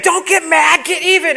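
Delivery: high, loud scream; screamed mantra. Retold or imitated. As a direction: scream it like a fierce soccer mom. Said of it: "she would scream it".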